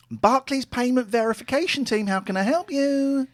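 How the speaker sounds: high, loud call-centre lady. Acted. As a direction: sing it up high as the operator. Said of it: "I'm a lady".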